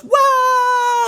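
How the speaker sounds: high, loud imitated crowd cheer; crowd scream. Protesters answering the call to march, imitated scream. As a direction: scream it like a roused mob. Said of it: "and screams".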